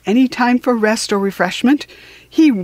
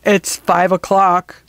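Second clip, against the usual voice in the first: Quong's voice. A narrator's reading.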